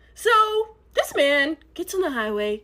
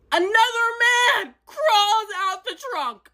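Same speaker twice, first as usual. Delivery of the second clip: shocked disbelief; 9 semitones higher, 4 dB louder than usual. Retold or imitated. Telling viewers a man came out the trunk, stunned. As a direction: drag it out in shock.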